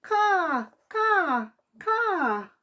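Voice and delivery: high squawking bird calls; puffin caws. Acted. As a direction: squawk like panicked seabirds.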